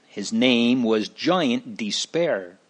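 usual speaking voice

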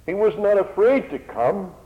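high, loud building sermon; fervent preaching. Acted. Preacher builds to climax before hearers, high and loud. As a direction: drive it home to the congregation.

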